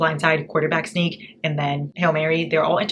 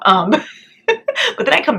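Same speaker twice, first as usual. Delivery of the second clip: laughing through the words, louder; a real laugh breaking into the sentence; 12 semitones higher, 8 dB louder than usual. Real, not acted. To viewers about a cringy series, laughing. Laughing at her own complaint.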